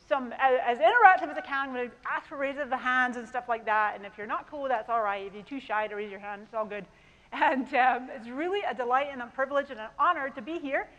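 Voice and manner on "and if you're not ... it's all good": usual speaking voice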